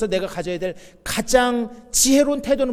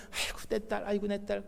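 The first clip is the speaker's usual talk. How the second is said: soft, cooing father's voice; doting dad; 10 dB quieter than usual. Retold or imitated. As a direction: coo quietly over your little daughter. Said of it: "물고 빨고 막 꼭 껴안고 하던 아빠".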